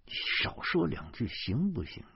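very soft, irritated undertone; hushed rebuke. A narrator's reading. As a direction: shut him up under your breath. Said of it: "小声地说". (压低声音小声责备)

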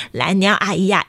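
plain reading voice

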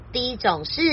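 ordinary delivery, nothing marked

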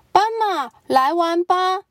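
high, calling out to a friend; shouting. Acted. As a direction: call out playfully. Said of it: "他们大喊".

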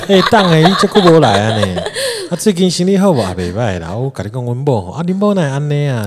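high, loud, laughing hostess greeting regulars in Taiwanese; hammed-up flattering hostess with a laugh; hostess bar lady. Hostess greets a regular customer, hammed-up and loud. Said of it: "很像酒，酒店小姐".

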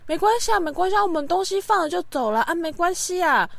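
high, breezy mimic of the parents; mimicking parents. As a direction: brush it off like mom. (模仿爸妈轻松带过的语气)

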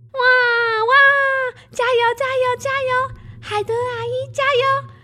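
put-on high, loud excited child's shouts; frantic cheering. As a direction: yell encouragement like a thrilled girl. Child cheers during birth.

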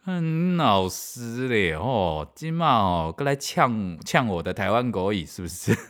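slow mock Taiwanese Mandarin challenge. Imagining the doctor's retort, hammed-up Taiwanese Mandarin.

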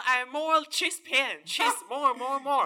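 slow, loud, drawn-out broken English; mock the foreigner, stretching each word; hammed-up broken English with a laugh. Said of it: "你有没有跟他说".

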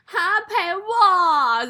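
slow, high, mock-whiny plea; whiny girlfriend. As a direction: stretch it out like a clingy girlfriend.

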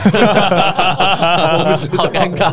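laughing it off, embarrassed; nervous laugh. Real, not acted.

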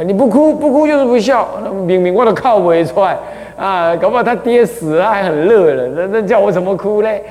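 mildly mock-indignant grieving son protesting he can't cry; exasperated son. Voicing a son forced to cry.

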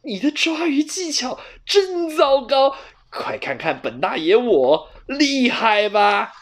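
high, slow showing-off cat; smug boasting. A narrator's reading. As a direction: gloat over the catch. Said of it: "球球得意地说". (得意的球球，慢悠悠地炫耀)

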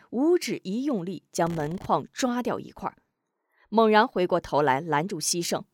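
ordinary delivery

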